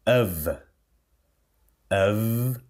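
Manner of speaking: "of" said as "uv", held buzzing V; V sound ending. Shown as a demonstration. Teacher drilling the word, "of" ending in a V. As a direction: end "of" on a voiced V, not F. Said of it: "termino de som de V".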